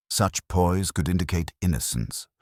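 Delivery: ordinary delivery, nothing marked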